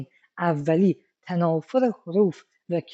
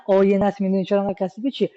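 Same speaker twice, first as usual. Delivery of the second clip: fast casual line; much faster than usual. Shown as a demonstration. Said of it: "نه".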